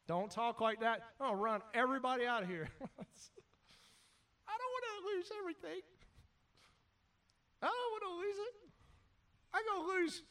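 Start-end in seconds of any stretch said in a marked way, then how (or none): 2.8-10.2 high, soft, whimpering worry; whiny churchgoer. Acted. Preacher mimicking a churchgoer afraid to lose everything, high and whiny. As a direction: whine like a scared believer.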